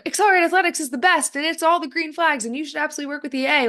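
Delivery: high-pitched mock promotional yelling; mock scream. Coach parodying a plug for their own company, mock screaming. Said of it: "not to scream".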